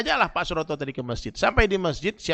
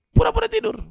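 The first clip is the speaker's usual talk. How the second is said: much higher, louder squeal of mock disbelief; preacher teasing the congregation, high and loud; mocking high exclamation; 12 semitones higher, 12 dB louder than usual. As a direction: throw it up high to tease the crowd.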